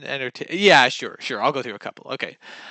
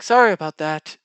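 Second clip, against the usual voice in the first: quoted apology; 4 semitones higher, 8 dB louder than usual. Retold or imitated. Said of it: "She's like".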